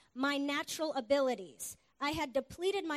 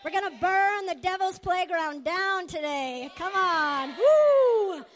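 rising to a full-throated, much higher and louder rally cry ending in a whoop; preaching shout; 5 semitones higher, 12 dB louder, slower than usual. Real, not acted. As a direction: fire up the crowd and let it all out on the woo.

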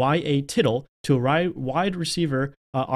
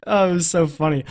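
ordinary delivery

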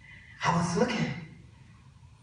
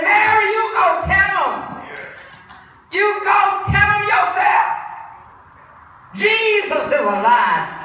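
high, loud preacher voicing a command; shouted command; 14 semitones higher, 9 dB louder than usual. Jesus sends Mary to tell the news, preached shout. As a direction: send her off with a ringing shout.

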